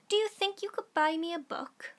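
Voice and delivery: put-on high, eager little girl asking; girl's plea. Little girl asking her dad for a book, high and hopeful.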